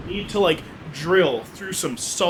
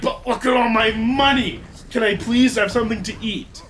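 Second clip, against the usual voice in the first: higher, begging bear voice; pleading Blue Bear; 5 semitones higher than usual. Acted. Blue Bear begging the old people for food. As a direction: beg for food while proud of the gold.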